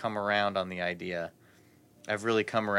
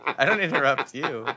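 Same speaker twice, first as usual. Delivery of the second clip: laughing protest, louder and higher; 5 semitones higher, 5 dB louder than usual. Real, not acted. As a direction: laugh while complaining.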